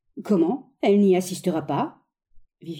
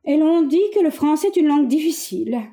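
full conviction; 6 semitones higher, 3 dB louder than usual. Acted. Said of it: "Au public avec pleine conviction".

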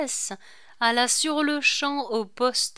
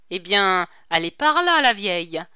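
raised voice, pitched up; said aloud; 8 dB louder than usual. A narrator's reading. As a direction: call it out for all to hear. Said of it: "reprit-il à haute voix".

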